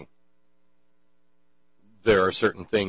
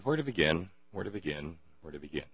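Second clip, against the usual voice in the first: each repeat softer, lower, trailing off; fading away; 6 semitones lower, 12 dB quieter, faster than usual. Acted. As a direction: let the question fade into silence. Repeats phrase, fading each time.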